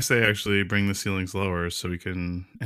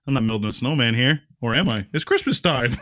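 normal talking voice

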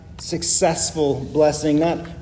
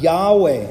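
Yahweh said louder with a falling tune; emphatic Yahweh; 4 dB louder than usual. Shown as a demonstration. Preacher stressing the covenant name, emphatic Yahweh. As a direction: lean hard on the name Yahweh. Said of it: "start with the emphatic".

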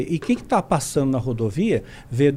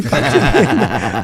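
laughing mock urging; 6 semitones lower, 6 dB louder, much slower than usual. Wife urging him to hit the man.

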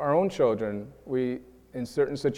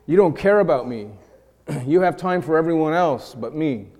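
accusing; 3 semitones higher, 6 dB louder than usual. Retold or imitated. Preacher voices an accuser.

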